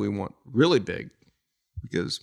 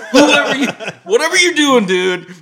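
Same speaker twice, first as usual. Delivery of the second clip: high, loud, laughing through the quote; giggling as he quotes himself; 12 semitones higher, 11 dB louder than usual. Real, not acted. Quoting himself, cracking up.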